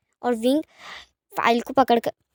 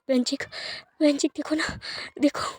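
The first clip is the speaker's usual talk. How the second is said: crying; 2 semitones higher, 4 dB quieter than usual. Acted. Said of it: "आंसू बह रहे होते हैं".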